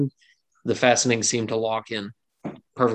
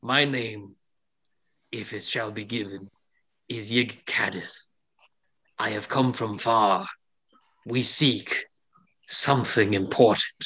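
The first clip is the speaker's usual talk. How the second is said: feminine reptilian woman; 2 semitones lower than usual. Acted. Reptilian traveller giving her name, mildly embarrassed. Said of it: "in a more feminine voice".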